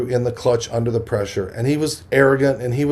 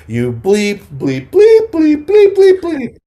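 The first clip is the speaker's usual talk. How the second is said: high, loud crowing yell at the heckler; retold loud taunt, hammed-up and gleeful; 7 dB louder, slower than usual. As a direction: shout the bleeps back in triumph. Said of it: "started screaming at that guy".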